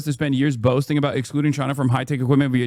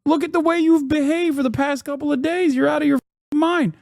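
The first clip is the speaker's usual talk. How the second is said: mock shouting back; 12 semitones higher than usual. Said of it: "It's like".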